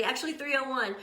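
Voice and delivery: normal talking voice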